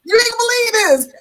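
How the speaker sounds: high, loud burst of excitement; excited disbelief. Retold or imitated. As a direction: announce it big and high, like amazing news. Excited retold news to new acquaintance.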